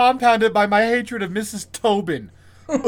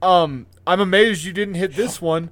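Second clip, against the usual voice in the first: ordinary talk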